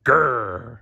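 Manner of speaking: playful pretend growl; grumpy growl. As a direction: growl like a grumpy cartoon bear. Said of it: "I'm Grumpy Bear".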